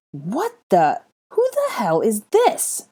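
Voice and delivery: high, loud, slow appalled yell; disgusted shout. A narrator's reading. Malfoy yelling at a clinging child, disgusted and loud. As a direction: recoil from a stranger in disgust. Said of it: "Draco shouted in disgust".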